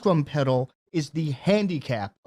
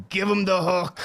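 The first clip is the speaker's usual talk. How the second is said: mildly raised mock heckle; imitated audience shout; 3 semitones higher than usual. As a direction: call it out like a heckler, half-strength. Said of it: "audience members shouted".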